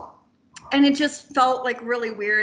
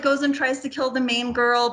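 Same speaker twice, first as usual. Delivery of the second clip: normal talking voice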